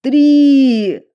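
high, loud cry through pain; pained shout. A narrator's reading. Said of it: "превозмогая боль, крикнул Эрик". (крик сквозь боль)

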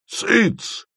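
a sharp, very high bark of a hush; a barked hush, way up in pitch. A narrator's reading. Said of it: "рявкнул Вараксин".

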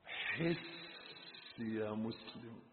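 very soft, low and drawn out; hushed plea. Real, not acted. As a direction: slowly breathe the appeal to the believer. Same appeal, hushed then shouted. (مناشدة خافتة بطيئة)

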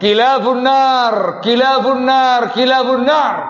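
high, loud and slow, each kilabun nar hammered down; sharp condemnation. Retold or imitated. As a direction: thunder the quoted curse three times. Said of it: "Itu bahasa yang sangat tajam". (kecaman tajam, keras dan lambat)

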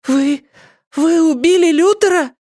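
very high, loud faltering shriek; falter, then shriek the accusation; high girl's cry of shock; horrified cry. A narrator's reading. Girl backs away accusing in horror.

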